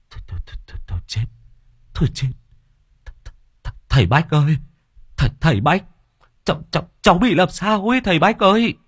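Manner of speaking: panicked stutter, rising to a high frightened plea; terrified young men calling the shaman; stuttering panic, pitch climbing; stammering in fear. Acted. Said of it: "Chúng nó lắp bắp".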